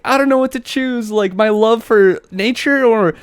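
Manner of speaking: put-on high-pitched, torn kid voice; hammed-up tearful indecision; mock crying little girl. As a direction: imitate a child agonizing over a choice.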